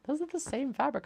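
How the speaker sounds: high-pitched imitation of an objection; mock objection. Companion objecting to the fabric purchase, mock high-pitched.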